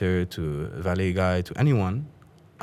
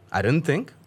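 ordinary talk, nothing marked

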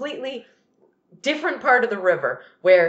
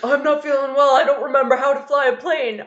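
loud, raised mock plea; confused pilot protesting; 6 semitones higher, 5 dB louder than usual. Sam telling professionals he can't fly, mock protest. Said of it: "even when he's like".

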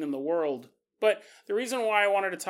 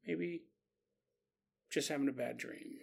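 drowsy words trailing off into a murmur; sleepy mumble; 5 semitones lower, 12 dB quieter than usual. Retold or imitated. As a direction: mumble it half asleep, fading out. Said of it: "Samantha says as she rolls over".

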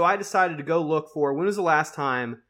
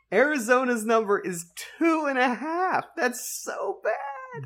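mock dismay; 9 semitones higher than usual. Mock despair at low total.

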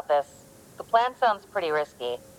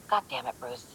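much softer, sighed-out curse; exasperated sigh; 4 semitones higher, 11 dB quieter than usual. Acted. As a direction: mutter it under your breath, fed up. Partner ignores her radio calls.

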